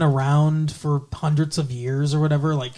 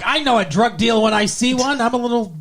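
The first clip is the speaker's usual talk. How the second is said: higher-pitched mock busybody; mocking Snyder voice; 6 semitones higher than usual. Snyder bursting in on a supposed deal, mockingly high. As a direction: lightly send up a self-important official.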